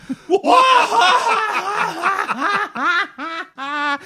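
long shrieking cackle; mocking high-pitched laugh. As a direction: laugh hysterically. Boba cracks up.

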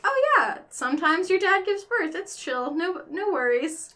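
mock-blasé, high-pitched breezy reassurance. Explaining how dads giving birth is no big deal, blasé. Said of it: "like, blasé".

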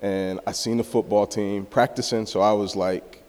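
usual speaking voice

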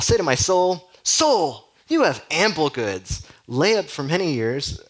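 high, loud self-congratulation, slowed; hammed-up smug rich man; gloating to himself. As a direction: pat yourself on the back as the rich fool. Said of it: "gloating to himself".

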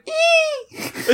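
very high delighted squeal; excited squeal. Retold or imitated. Pointing at the screen, a high excited squeal. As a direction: squeal with glee. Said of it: "pointed at the screen and went".

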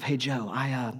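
Mary pulls Joseph aside at dinner, hushed and nervous; mock hushed whisper. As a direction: lean in and murmur it, fast on the middle. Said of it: "Mary whispers over to Joseph".

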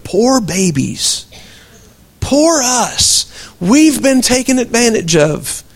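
slow mocking whine of self-pity. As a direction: tease the congregation with fake sympathy. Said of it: "always so worried about being taken advantage".

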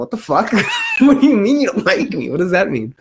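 high, laughing disbelief; incredulous journalist. Retold or imitated. Host imitating journalist snapping at Trump, incredulous. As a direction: play the baffled journalist. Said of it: "the journalist was like".

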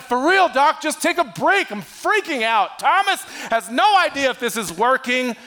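high, loud, panicky pleading; hammed-up stressed disciples begging their teacher for rest; frantic disciples. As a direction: beg for a break like exhausted friends freaking out. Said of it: "They're like".

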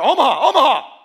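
shouted high audible call; quarterback calling cadence. Retold or imitated. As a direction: bark it like a quarterback at the line. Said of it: "was he like Peyton Manning up there".

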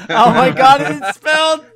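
very loud, high shout through laughter; laughing outburst. Real, not acted. Friend cracks up at the misspelling, real laughing shout. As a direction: shriek it while cracking up.

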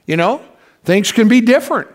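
ordinary talk

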